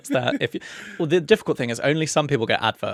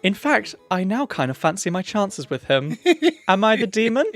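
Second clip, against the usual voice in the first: letter reading; 5 semitones higher, 4 dB louder than usual. Acted. Reads listener letter aloud.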